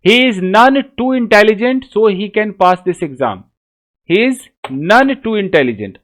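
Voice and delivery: very slow reading, none stressed. Shown as a demonstration. Teacher reading the sixth example slowly. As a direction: read slowly, leaning on none. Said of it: "छठा देखिए".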